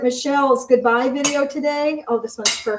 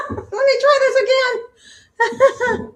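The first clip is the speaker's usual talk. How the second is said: real giggle, much higher, slow through laughter; laughing; 13 semitones higher, 5 dB louder, much slower than usual. Real, not acted. Climbing a tall chair on camera, laughing. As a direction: laugh at yourself while trying again.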